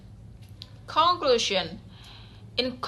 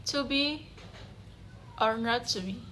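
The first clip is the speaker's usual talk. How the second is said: soft recitation; 8 dB quieter than usual. Shown as a demonstration. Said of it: "The third aspect is prosody".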